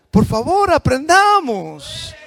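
loud pleading. Real, not acted. Pastor begging the congregation to learn, loud drawn-out plea. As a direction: beg them to learn, stretching the word out. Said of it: "pastor pleading with congregation".

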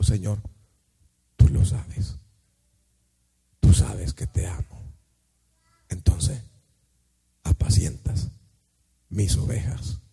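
breathy weeping voice barely above a whisper; tearful whisper. Acted. Peter answers Jesus in tears, whispering. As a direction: let the words break as if through tears. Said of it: "Y llorando Pedro le dijo".

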